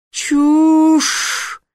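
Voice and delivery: high, loud outraged shriek; furious yell. A narrator's reading. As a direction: scream it in fury. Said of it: "завопил Сфинкс".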